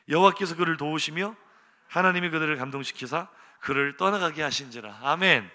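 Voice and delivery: loud unison Bible reading; scripture reading. Acted. Congregation reading the verse aloud, loud reading. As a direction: read the verse aloud together.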